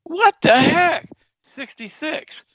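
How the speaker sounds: slow, high stunned "what the heck"; stunned disbelief. Retold or imitated. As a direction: drag it out in shock. Shocked at hospice news.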